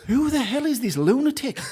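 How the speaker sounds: high-pitched, comic disbelief; incredulous outburst. Retold or imitated. Retelling his private reaction to a baffling interviewer, incredulous outburst. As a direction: exclaim it high, half laughing.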